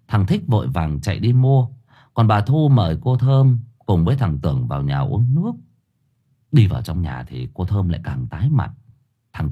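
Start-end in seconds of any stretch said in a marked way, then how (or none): none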